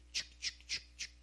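soft unvoiced chuc of moving branches; rustling noise. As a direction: breathe out the rustle of leaves. (susurro imitando ramas)

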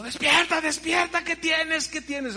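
high, urgent mock shout; husband shouting. Husband trying to wake his fainted wife, urgent high calling. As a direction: shake her awake with alarm. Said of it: "oigo los gritos de mi esposo".

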